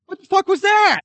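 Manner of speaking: shrieking "what the fuck"; high, loud incredulous yelp; shocked disbelief. Real, not acted. Reacting to the movie ending.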